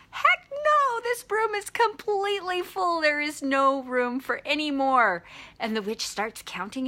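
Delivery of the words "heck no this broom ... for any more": very high mock-indignant cat, easing down; outraged cat. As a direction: refuse in a hammed-up high squeal that gradually settles. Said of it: "the cat's over there going".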